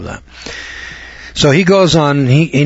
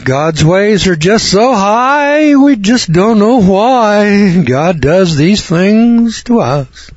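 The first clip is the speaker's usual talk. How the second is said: high, slow, pious mock drawl; mocking a Holy Joe; 7 semitones higher, much slower than usual. Preacher mocking a Holy Joe's excuse, high and slow. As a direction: ham up the sanctimonious preacher. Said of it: "some Holy Joe out here, he says".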